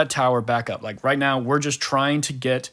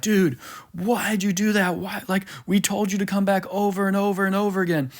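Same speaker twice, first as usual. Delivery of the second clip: imitated yelling; 6 semitones higher than usual. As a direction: imitate exasperated teammates yelling, pitched up without the volume. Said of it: "they're literally yelling at him".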